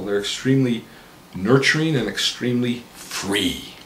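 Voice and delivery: ordinary talk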